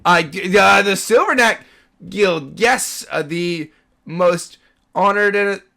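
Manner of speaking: loud, high, halting official announcement; booming proclamation. Acted. Guard hails the guild members at the gate, booming proclamation. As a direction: proclaim it grandly while groping for words.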